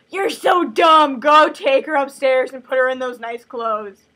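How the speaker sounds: loud, drawn-out scolding shout; exasperated scolding. Acted. Sisters scold Miranda over the clothes, exasperated shout. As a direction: yell it at a slow-witted servant.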